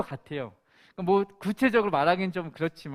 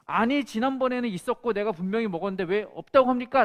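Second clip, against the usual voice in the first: louder, raised angry complaint; retold angry protest; 5 semitones higher, 6 dB louder than usual. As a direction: protest like a customer who feels cheated. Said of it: "라고 화를 내니". (따지듯 화난 목소리로)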